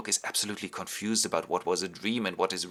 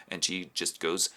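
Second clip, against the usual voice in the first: ordinary delivery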